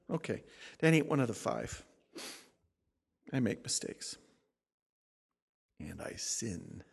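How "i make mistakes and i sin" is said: softer and lower, slow on sin; quiet confession. Real, not acted. Pastor humbly admitting his sin to the church, softer. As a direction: drop to a humble hush.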